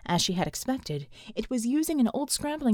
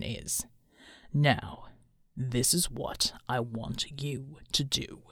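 deep, quiet, deliberate commander; stern; 9 semitones lower, 5 dB quieter, slower than usual. A narrator's reading. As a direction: lay down the plan slowly and low. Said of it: "Bulwark said sternly".

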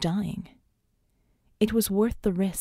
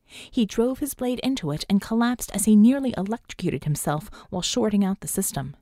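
plain reading voice

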